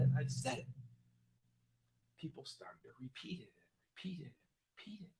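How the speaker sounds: hushed, breathless whisper. Real, not acted. Telling the story of the slogan spreading, whispered.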